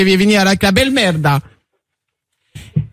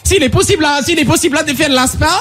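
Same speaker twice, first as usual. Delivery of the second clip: high, singsong mock Italian; put-on Italian accent, pitched up; 3 semitones higher than usual. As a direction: improvise a phone call in hammed-up Italian. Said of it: "tu passes ton cousin italien".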